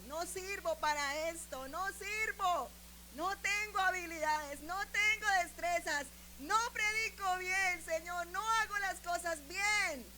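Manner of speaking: high, insistent self-reproach; desperate retold lament. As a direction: cry out each failing to God. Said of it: "le dije al Señor estas palabras".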